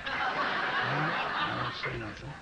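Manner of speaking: slow, low through laughter; laughing. Real, not acted. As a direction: choke it out laughing.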